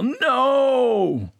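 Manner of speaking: sudden high, loud "No!" of protest; yell. Pretend. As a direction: cry it out to stop the bad advice. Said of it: "I wanna stand up and yell".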